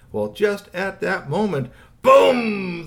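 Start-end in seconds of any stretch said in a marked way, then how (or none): 2.0-2.9 loud, drawn-out imitated boom; explosive boom. A narrator's reading. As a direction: boom it out suddenly. Door bursts open in story.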